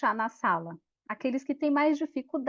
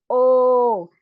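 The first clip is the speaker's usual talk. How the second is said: loud call; 8 dB louder than usual. Shown as a demonstration. Teacher demonstrates, calling the letter loud.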